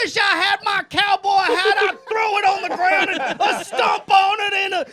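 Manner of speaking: loud, high mock-cowboy shouting; hammed-up Yosemite Sam holler; cowboy rant. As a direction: play an angry cartoon cowboy stomping his hat.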